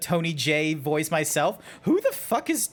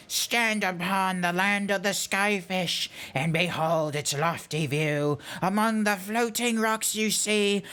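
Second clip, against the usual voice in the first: put-on higher, theatrical old scholar with teeth stuck out; weary character voice, amazed; 4 semitones higher than usual. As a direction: marvel grandly, then recite the verse slurred. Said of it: "the voice that I was able to come up with".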